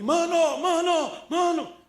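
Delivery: high, loud calling out; a homeless man on the beach shouting for a runner. Retold or imitated. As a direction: call after him, laughing. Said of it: "ele grita pra mim".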